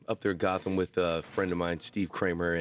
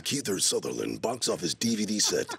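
trailer guy voice; 2 semitones lower than usual. Retold or imitated. LaFontaine reading a voiceover.

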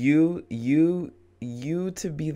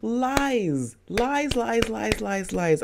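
high, angry repeated shouts of lies; furious yelling; 8 semitones higher, slower than usual. Real, not acted. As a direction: hurl each lies at her, outraged. Streamer yells at game mother.

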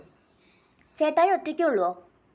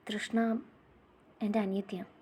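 much softer, heavy with held-back tears; tearful brother; 7 dB quieter than usual. Acted. Brother in tears, naming his sister to the little girl. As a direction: let grief choke the words quietly.

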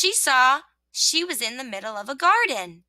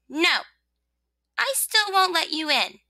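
high, insistent refusal; refusing rosebud; 7 semitones higher than usual. Acted. Rosebud refusing the rain entry, insistent. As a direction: hold firm in a raised pitch.

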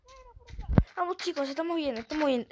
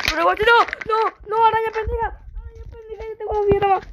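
high frantic yelling; panicked shouting; 9 semitones higher, 4 dB louder, much faster than usual. Real, not acted. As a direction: shriek in real panic. Spider attack in game.

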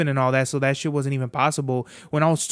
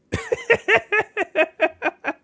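a real, high burst of laughter. Real, not acted. After joking that the mission is bitches, real high laugh. Laughs at his own joke.

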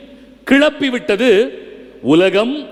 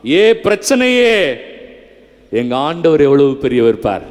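demonstrated bold prayer; 6 semitones lower than usual. Shown as a demonstration. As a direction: declare it firmly to your troubles. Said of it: "எப்படி ஜெபம் பண்ணுமா?".

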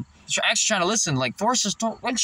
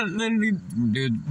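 normal talking voice

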